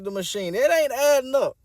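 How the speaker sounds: normal talking voice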